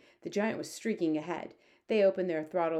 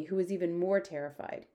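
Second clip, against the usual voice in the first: usual speaking voice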